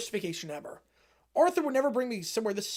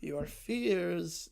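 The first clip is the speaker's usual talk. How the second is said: Vecna voice; 5 semitones lower, 7 dB quieter than usual. Acted. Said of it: "Vecna's voice echoes".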